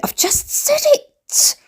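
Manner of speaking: very high, slow strained whine; drawn-out squeaky self-annoyance; frustrated squeak. Real, not acted. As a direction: squeeze it out in high exasperation. Frustrated repeat of forgotten word.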